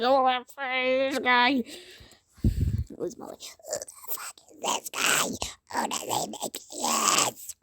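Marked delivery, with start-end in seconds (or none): 0.0-1.6 drawn-out mock outburst in a put-on voice; Shigaraki. Streamer first tries out the villain's reaction, drawn-out put-on voice. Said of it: "Because that's Shigaraki's crusty ass voice". 3.4-7.6 crusty, whispery villain rasp, slow; Shigaraki. Acted. Streamer corrects their impression of the villain's disbelief, slow crusty whisper. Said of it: "Because that's Shigaraki's crusty ass voice".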